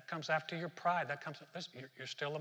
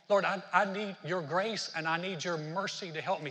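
loud pleading prayer; 3 semitones higher, 7 dB louder than usual. Retold or imitated. Voicing an urgent prayer.

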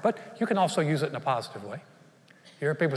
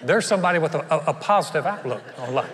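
chuckling through the words, amused; laughing; 4 dB louder than usual. Real, not acted. Preacher laughing at the punchline to his congregation, laughing. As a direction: laugh as the story lands.

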